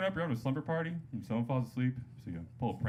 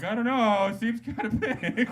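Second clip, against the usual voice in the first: mock defensive; 9 semitones higher, 6 dB louder than usual. Student acts defending his too-large drawing.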